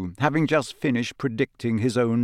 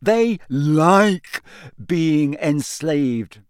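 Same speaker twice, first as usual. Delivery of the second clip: louder, much higher and slow; loud insistence; 5 semitones higher, 5 dB louder, much slower than usual. Acted. Ron insisting to Hermione, loud and slow. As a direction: hammer the point home loudly. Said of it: "said Ron loudly".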